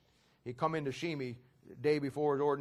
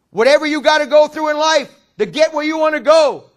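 shouted high sermon, slow on key lines; fiery pulpit shouting; full-force preaching; 7 semitones higher, 17 dB louder than usual. Acted. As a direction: preach it at the top of your lungs.

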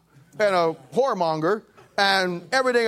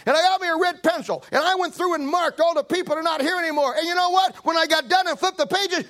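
excited lady; 10 semitones higher than usual. Retold or imitated. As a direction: tell it like a thrilled churchgoer. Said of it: "She says".

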